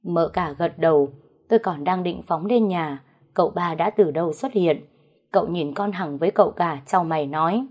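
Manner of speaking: ordinary delivery, nothing marked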